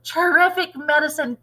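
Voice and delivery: put-on high, loud greedy old woman; crying out. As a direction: demand it with wild glee. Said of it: "she cried".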